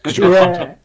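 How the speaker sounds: mock accuser. Mocking the hunters' critics.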